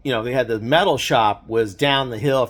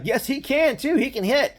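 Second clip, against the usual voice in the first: high, eager protest; he's sure and wants everyone to know it; insistent teammate; 11 semitones higher than usual. Retold or imitated. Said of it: "one of the guys like".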